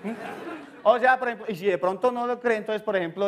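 normal talking voice

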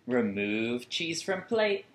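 ordinary delivery, nothing marked

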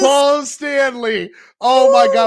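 high, stretched-out cry of triumph; ecstatic. Real, not acted. Collector announcing the long-awaited card, ecstatic. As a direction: crow the name slowly, up high.

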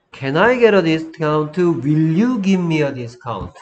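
slow, loud, clear classroom model; model sentence. Shown as a demonstration. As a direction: say it slowly for students to repeat. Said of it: "listen-and-repeat model". (또박또박 천천히)

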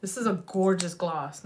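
ordinary delivery

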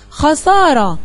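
خسارة stretched slowly, syllable by syllable; slow, drawn out. Shown as a demonstration. Teacher presenting a word to the class, slow and stretched. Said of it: "يا ترى أصلها إيه".

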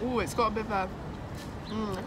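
tasting between words; chewing while talking. Real, not acted. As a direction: mouth partly full. Seen 1: Jaw works sideways with lips pressed between words; grimacing while tasting.

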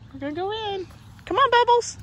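high, singsong summons; coaxing dog call. Real, not acted. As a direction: coax a dog indoors in a high voice. Calling dogs indoors.

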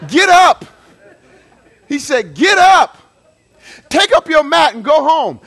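loud, firm command "Get up."; commanding shout. Shown as a demonstration. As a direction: bark "Get up." with full authority. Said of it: "he didn't say it like that".

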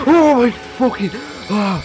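high loud startled shriek; high panicked exclamation; frightened cry. Real, not acted. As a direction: scream as it jumps you. Jump scare.

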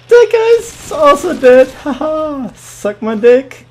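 high, loud, triumphant crowing; gloating. Real, not acted. Gamer scores a second kill, gloating loudly. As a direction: crow over the kill with a taunting haha. Gloating over two kills.

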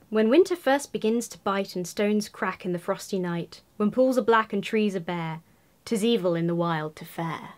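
ordinary delivery

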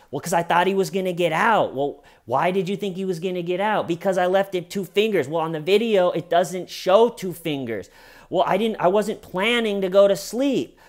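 high, quick back-and-forth bickering; hammed-up, insistent squabble; mock interrogation argument. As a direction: fire question and excuse back and forth, imitating both.

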